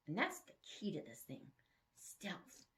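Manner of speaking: breathy whispered single word; sneaky whisper. Acted. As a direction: hush it like a secret. Hero's secret sneaking thought.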